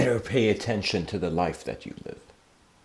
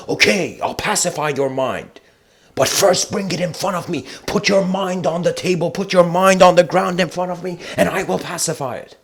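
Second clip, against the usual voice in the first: higher, firm command; commanding master; 8 semitones higher, 4 dB louder than usual. Retold or imitated. Bodhidharma telling the man to bring his mind, firm command. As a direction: order him like a stern teacher.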